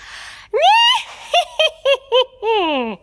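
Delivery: shrieking high witch cackle, far forward in the face. Shown as a demonstration. A singing teacher demonstrates her witch cackle. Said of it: "You try".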